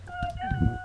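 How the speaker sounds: ordinary talk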